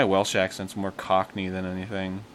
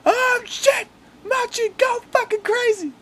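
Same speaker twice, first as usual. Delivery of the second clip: very high, loud squealed swearing; re-enacted high-pitched playful shouting; retold excited yelling; 9 dB louder, slower than usual. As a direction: hype up the cat with mock screams. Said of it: "I was just like".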